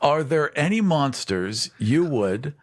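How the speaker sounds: usual speaking voice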